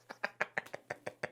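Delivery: silent wheezing laugh; breathy laugh. Real, not acted. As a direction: laugh helplessly, almost no voice. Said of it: "I can't get through that one without laughing".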